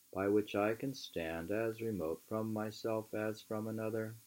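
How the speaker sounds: measured, emphatic book reading; reading aloud. Acted. Reading Thoreau aloud to a friend, measured and emphatic. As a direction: read the old essay aloud with weight.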